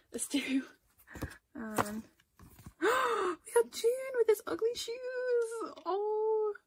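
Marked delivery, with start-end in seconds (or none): 2.8-6.5 high, slow, squealing delight; excited squeal. Real, not acted. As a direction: squeal it out, thrilled to bits. Thrilled at pulling a favourite.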